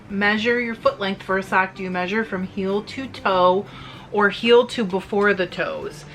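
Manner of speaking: ordinary talk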